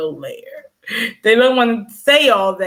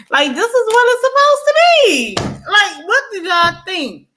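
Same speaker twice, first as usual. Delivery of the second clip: much higher and louder, fast; exasperated outburst; 13 semitones higher, 4 dB louder than usual. Real, not acted. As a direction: blow up in disbelief at everyone.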